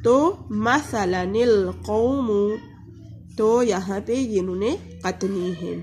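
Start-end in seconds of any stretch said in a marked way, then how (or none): 0.6-2.6 "मसलनिल कौमु" drawn out, nun joined to lam; slow Quranic recitation. Shown as a demonstration. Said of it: "लाम के साथ मिला के पढ़ेंगे".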